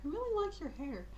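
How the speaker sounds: hushed, fascinated compliment; admiring compliment. Acted. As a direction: coo it lightly. [name] admiring Rory's hair.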